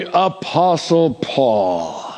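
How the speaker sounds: APOSTLE Paul drawn out slowly and grandly; pompous emphasis. Acted. Preacher mocking a demand to be called by title, pompous emphasis. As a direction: stretch "Apostle" like a big title. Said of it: "put emphasis on that word".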